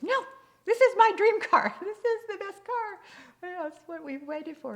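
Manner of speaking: very high, loud mock elation; hammed-up thrilled husband refusing doubt; insistent excitement. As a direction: protest with overblown delight. Husband insists against wife's doubt.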